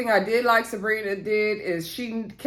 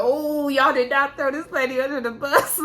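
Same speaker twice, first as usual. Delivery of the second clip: higher-pitched amused outrage; mock scandalized; 6 semitones higher than usual. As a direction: react like scandalized gossip, pitched up. Amused mock shock at the betrayal.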